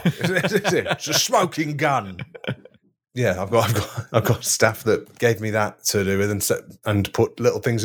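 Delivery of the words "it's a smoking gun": laughing with glee, slowing down; gleeful laughing. Acted. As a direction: savour it, chuckling. Acting gleeful villain.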